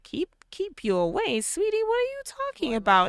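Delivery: puzzled sweetie; mock surprise. Mother-in-law puzzled by her son's wife, high incredulous. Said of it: "George is just like".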